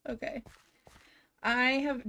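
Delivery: ordinary talk, nothing marked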